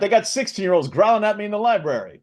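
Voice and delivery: laughing comic whine; Rodney Dangerfield impression. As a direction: Dangerfield bit, cracking up. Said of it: "the Rodney Dangerfield thing".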